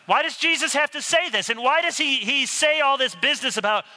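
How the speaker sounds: high-pitched exasperated questioning; baffled complaint. Acted. Skeptic questioning all this business from Jesus, baffled. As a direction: demand to know why on earth.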